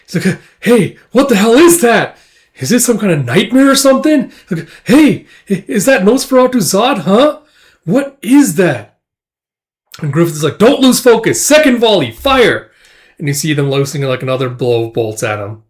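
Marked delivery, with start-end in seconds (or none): 0.6-4.3 high, loud alarmed questioning; acted panic at a monster; alarmed soldier. 4.8-9.0 high, loud startled questions; alarmed soldier. Acted. 10.6-12.7 high, loud battlefield order; shouted command. Acted.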